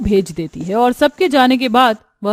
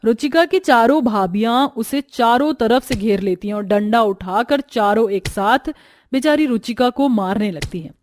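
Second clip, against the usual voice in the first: usual speaking voice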